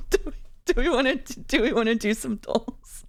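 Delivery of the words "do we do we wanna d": normal talking voice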